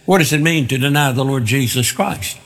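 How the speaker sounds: normal talking voice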